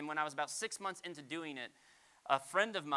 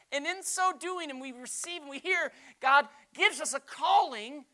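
impassioned preaching; 9 semitones higher, 9 dB louder than usual. Real, not acted. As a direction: keep the fervour, lean on each word. Preacher stays elevated.

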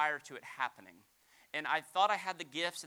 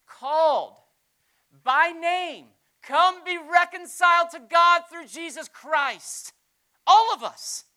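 high, ringing, much louder proclamation; preaching at full force; 11 semitones higher, 17 dB louder, slower than usual. Real, not acted. Pastor proclaiming God's call to everyone, full-force preaching. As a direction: lift it to the back row, slow and big.

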